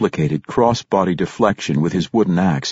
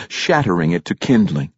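ordinary delivery

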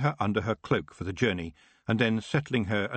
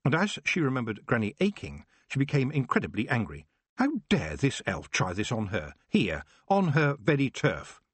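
ordinary delivery, nothing marked

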